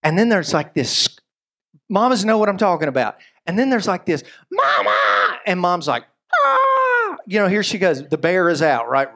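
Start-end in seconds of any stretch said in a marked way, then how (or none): 4.5-5.4 mock cry for mama; hammed-up child's scream; imitated child's yell. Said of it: "like, yelling sounds like". 6.3-7.2 long, very high scream; piercing held "ah"; mock alarmed scream. Mom reacting to child's alarming scream.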